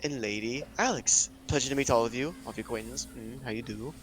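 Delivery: put-on affected posh accent with trailing pleasantries. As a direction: make lordly introductions in a posh accent. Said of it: "[name] has a new accent".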